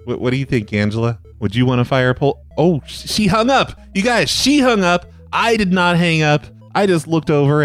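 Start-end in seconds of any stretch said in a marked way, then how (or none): none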